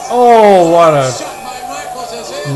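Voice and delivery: very slow, stretched-out wow; drawn-out commentator exclamation. Acted. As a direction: stretch every word like a hyped announcer. Commentator reacting to big hit.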